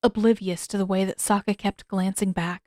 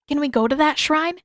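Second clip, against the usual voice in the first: higher, eager and bright-eyed; eager question; 7 semitones higher, 4 dB louder than usual. A narrator's reading. Aang eagerly asking Yue to go to the shrine, higher and eager. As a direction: jump at the chance to go. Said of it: "Aang asked eagerly".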